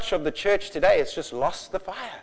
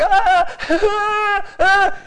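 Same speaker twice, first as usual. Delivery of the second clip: loud, high mock wails; imitated agony cries; 8 dB louder, much slower than usual. Preacher showing children on the ground, imitated agony wails. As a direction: cry out as if in agony, hammed up. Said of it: "looked like they were in agony".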